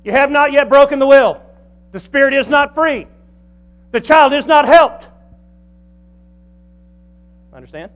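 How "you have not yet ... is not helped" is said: high, loud pulpit declarations; forceful sermon proclamation; preaching at full force. Real, not acted. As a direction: hammer out each statement, high and loud.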